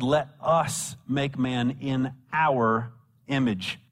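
slow reading, "us" and "our" set apart; stress on us and our. Shown as a demonstration. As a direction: lean on "us" and "our" with deliberate pauses. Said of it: "Notice what he says".

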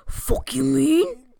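loud, drawn-out Long Island comeback; Long Island accent. Retold or imitated. Offended Long Islander answering the podcast girl, loud mock-indignant Long Island accent. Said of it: "trashy, um, American accents".

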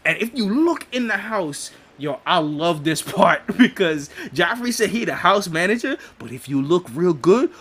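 ordinary talk

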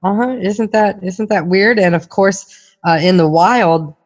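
normal talking voice

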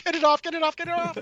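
shrill, frantic squeal; shaking off a spider. Retold or imitated. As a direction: squeal it like something's on your head. Imitated player attacked by creature.

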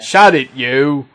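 loud, mock Aussie snap; bad Australian accent. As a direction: bark it in a hammed-up Australian accent. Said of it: "the accent was so bad".

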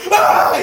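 loud high shriek with a laugh; startled scream. Real, not acted. Startled by a man behind her, loud shriek. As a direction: yelp in fright.

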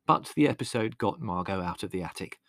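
usual speaking voice

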